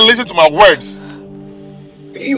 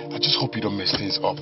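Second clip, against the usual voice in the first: low, soft, held-back anger; restrained warning; 10 semitones lower, 10 dB quieter than usual. Acted. Husband warning wife about the plan, low and restrained. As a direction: keep the warning quiet and controlled.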